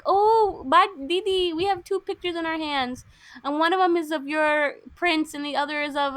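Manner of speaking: lightly voiced excited children, pitched high; kids' voices. Kids show Didi two pictures, in a lightly put-on high voice.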